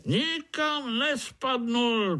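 very high, drawn-out contradiction; interrupting. A narrator's reading. Overseer interrupts spider's excuse about the lily, contradicting. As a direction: cut in with a high, emphatic denial. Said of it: "přerušil ho dozorce".

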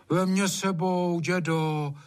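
high, drawn-out begging; slow, high-pitched childlike plea; pleading child. A narrator's reading. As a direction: beg grandpa to be taken along. Child begs grandfather to come along.

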